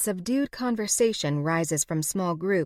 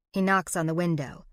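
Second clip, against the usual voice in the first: usual speaking voice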